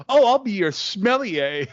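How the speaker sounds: slow lilting imitation of a pretentious server; mock fancy waiter. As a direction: ham up a snooty waiter's greeting. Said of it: "He's like".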